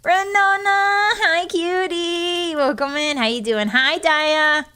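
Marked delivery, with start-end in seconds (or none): 0.0-2.5 high and slow, cooing the name; sweet drawn-out greeting. Real, not acted. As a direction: coo a fond hello to the viewer.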